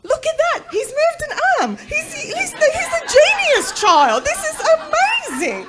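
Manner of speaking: shrieky high, loud mock raving; proud mum wildly overpraising her baby; over-the-top amazement. Mother raving over baby's tiny move.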